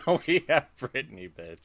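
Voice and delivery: laughing. Real, not acted. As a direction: crack up while repeating "Now we have Brittany". Repeats line, laughing.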